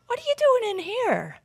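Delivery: high-pitched acted kid's question; high voice of a child finding his brother; puzzled older brother. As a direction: pitch it way up, like a boy surprised. Older brother finds boy in closet.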